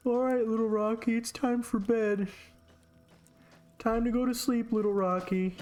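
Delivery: high sing-song goodnight to little Rocky; Charm tucking in his rock. Retold or imitated. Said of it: "He's like".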